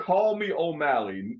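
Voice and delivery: normal talking voice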